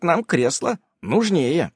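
plain reading voice

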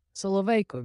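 understated desperate outburst; desperate exclamation. A narrator's reading. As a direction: call it out with a touch of desperation. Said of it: "відчайдушно вигукнув Архімед".

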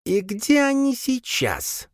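high, slow wary creature mother. A narrator's reading. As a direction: ask it on guard, slowly. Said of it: "настороженно спросила мать".

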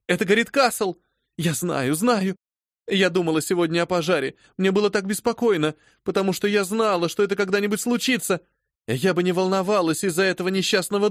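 high, distraught, a touch tearful; frantic sobbing. Acted. Phoebe frantically telling her mistress the Castle burns. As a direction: blurt it out frantic with fear. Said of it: "Обезумев, рыдала девушка".

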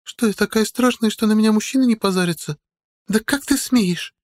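put-on high girlish voice turning indignant; hard, suspicious girl. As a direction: challenge him as an affronted girl, pitched high. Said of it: "Голос становится жёстким, подозрительным". (жёстко, подозрительно, высоким девичьим голосом)

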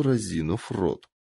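usual speaking voice

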